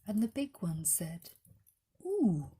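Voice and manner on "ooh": kindly bear ooh. Acted. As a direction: coo it gently like a comforting teddy bear. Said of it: "in the most kindly tones".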